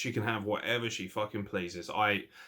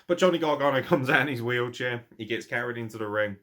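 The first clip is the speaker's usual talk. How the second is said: usual speaking voice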